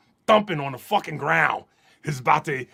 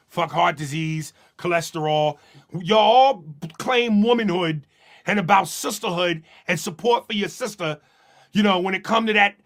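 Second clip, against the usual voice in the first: ordinary talk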